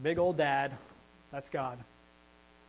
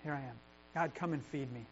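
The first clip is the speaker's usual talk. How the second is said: soft, hushed prayer of surrender; humble surrender; 10 dB quieter than usual. Shown as a demonstration. Believer simply comes to God to be fed, hushed. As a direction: quietly offer yourself up. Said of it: "just start out with honesty".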